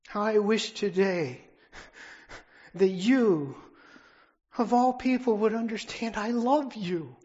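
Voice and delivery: How I wish today shouted with rising pitch; preacher's climactic cry of love; impassioned loud refrain. Real, not acted. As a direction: swell into a passionate plea. Repeated refrain, final climax.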